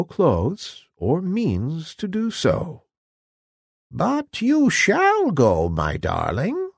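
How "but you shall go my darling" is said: put-on slow old-crone voice, swooping up high; croaking old woman. A narrator's reading. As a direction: promise it slowly as an old fairy godmother. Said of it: "said the old woman".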